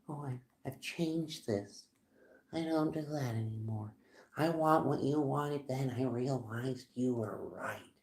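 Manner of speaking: soft, hushed voice of someone returning; soft pleading quote. Acted. Someone trying to come back in, imitated softly.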